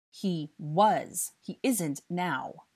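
"was" stressed, then slow "isn't now"; firm correction. A narrator's reading. Harry corrects the boy about the guest's past, "was" stressed. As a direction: correct him, leaning firmly on "was". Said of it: "Harry corrected".

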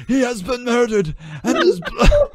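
high, loud ragged wail; ragged Judas. A narrator's reading. Judas confessing the murder, high ragged wail.

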